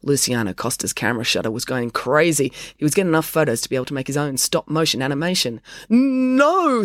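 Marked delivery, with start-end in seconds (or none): 5.9-6.9 high, loud, drawn-out denial; stammered. A narrator's reading. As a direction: stretch the N in a startled protest. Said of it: "stammered Joe".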